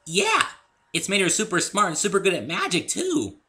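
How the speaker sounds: very high, loud bubbly pony; squeaky eager chatter; Pinkie voice. A narrator's reading. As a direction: bounce through it like Pinkie. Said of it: "said Pinkie".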